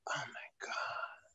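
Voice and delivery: breathless tearful whisper, very slow; whispered through tears. Retold or imitated. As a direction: choke it out in a whisper. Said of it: "And she was crying".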